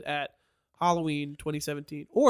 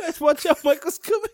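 mock warning call; 10 semitones higher, 4 dB louder than usual. Mock announcement, shouted warning.

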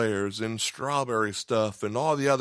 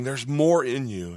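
ordinary delivery

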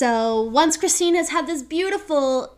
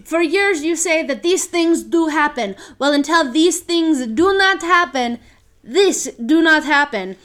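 put-on indignant diva, accented and louder; hissy fit; 5 semitones higher, 6 dB louder than usual. Carlotta storming at the new managers in a diva hissy fit. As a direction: throw a prima donna tantrum in broken English. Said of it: "goes into her hissy fit".

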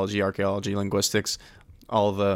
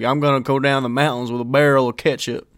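higher, louder mountain Southern twang; Appalachian twang; 4 semitones higher, 6 dB louder than usual. Shown as a demonstration. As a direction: put on a twangy Appalachian accent. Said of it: "got a little bit of twang to it".

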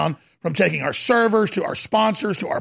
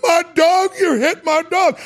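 high, loud mock wail of outrage; imitating outraged dog owner; 9 semitones higher, 3 dB louder, slower than usual. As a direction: mimic the owner's indignant shout. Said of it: "that guy looked at me and said".

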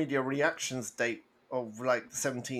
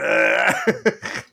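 Watto cackle; 7 semitones higher, 11 dB louder than usual. Acted.

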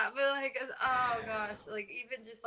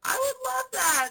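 delighted squeal; 4 dB louder than usual. Real, not acted. Eager yes to hosting together.

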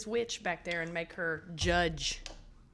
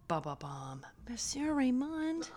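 dramatic announcement; 5 semitones higher, slower than usual. Acted. Dramatic introduction.

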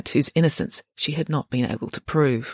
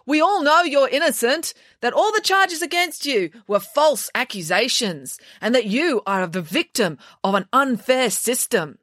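overly loud voice; 9 semitones higher than usual. Acted. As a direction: put it on for everyone listening, hammed up. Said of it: "said in an overly loud voice".

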